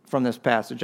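normal talking voice

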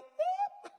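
sudden high burst of real laughter. Real, not acted. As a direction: let a bright laugh break out. Laughing at own awkward retreat.

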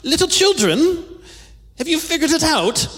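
put-on sugary condescending baby talk; patronizing. Shown as a demonstration. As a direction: coo it like to tiny kids, mockingly. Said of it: "Not so much".

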